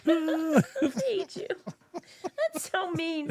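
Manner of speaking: high laughing through tears; teary laughing. Real, not acted. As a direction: fake anger while laughing and welling up.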